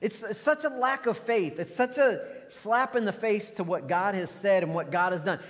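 normal talking voice

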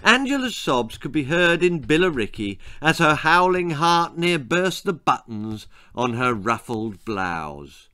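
usual speaking voice

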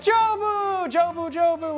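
high, goofy sing-song "Jobu, Jobu, Jobu"; squeaky high nickname chant; silly greeting. Retold or imitated. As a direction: call it out high like a playful nickname. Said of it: "we're like".

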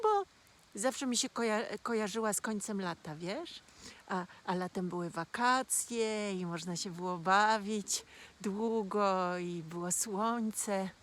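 plain reading voice

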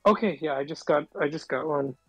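ordinary talk

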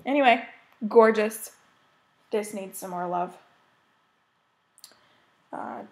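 ordinary talk

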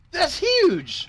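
high, loud shocked cry; alarmed exclamation. Real, not acted. Sees a huge enemy.

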